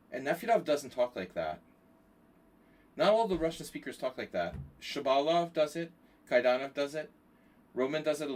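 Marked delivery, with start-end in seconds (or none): none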